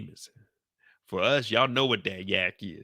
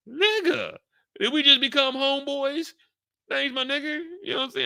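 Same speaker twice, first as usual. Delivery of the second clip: much higher, gushing thanks; mock delight; 14 semitones higher than usual. Thanking the guy for a free drink, much higher gush. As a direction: squeal with pleasure at a gift.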